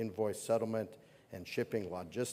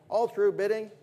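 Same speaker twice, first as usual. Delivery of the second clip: loud high call; 10 semitones higher, 8 dB louder than usual. Acted. Auctioneer final call.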